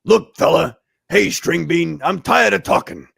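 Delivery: loud, tough brawler voice; impatient tough guy spoiling for a fight, slowing on the battle cry; the Thing. Shown as a demonstration. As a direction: belt it out like a comic-book bruiser. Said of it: "I have the best Thing voice".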